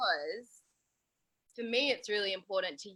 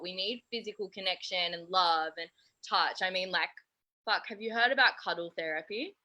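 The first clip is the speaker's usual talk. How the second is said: ordinary talk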